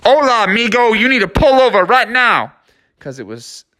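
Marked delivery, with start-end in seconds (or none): 0.0-2.5 higher mock loudspeaker call; a hint of a police loudspeaker. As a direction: announce like a cop on a megaphone. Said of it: "police on the loudspeaker".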